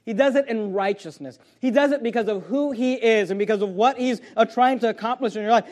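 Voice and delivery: ordinary delivery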